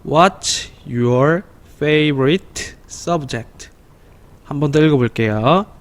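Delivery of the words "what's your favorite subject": very slow, word by word; teacher leads a slow choral reading; slow read-along. Shown as a demonstration. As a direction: read it slowly so children can follow. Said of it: "시작".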